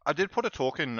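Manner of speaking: talking while chewing chocolate; chewing noisily. Real, not acted. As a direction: mouth full of chocolate. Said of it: "I'm just eating some chocolate".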